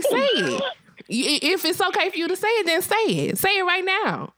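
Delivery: high, pressing dare; daring challenge. Retold or imitated. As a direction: egg him on to say it. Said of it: "he's like".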